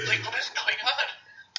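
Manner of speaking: laughing disbelief. Real, not acted. Mocking Cyborg's glowing sweatshirt, laughing.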